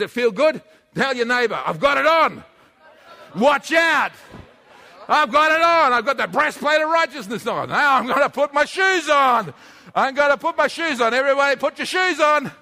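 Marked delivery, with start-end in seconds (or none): 1.6-12.5 louder, higher, triumphant call; shouting declaration. Acted. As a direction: shout it out to the whole room. Congregation repeats, preacher shouting.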